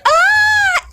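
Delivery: very high shrieked ah; crowd scream. Retold or imitated. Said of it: "people were like".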